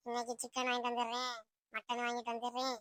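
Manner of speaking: soft, low promise; giving in. Acted. Mother promises chicken and mutton, giving in. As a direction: relent quietly, voice dropped.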